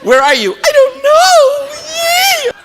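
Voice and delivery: high squealy giggling voice; mock childish excitement, very high; squealing glee. Mocking excited end-of-day crowd.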